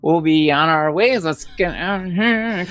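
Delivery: normal talking voice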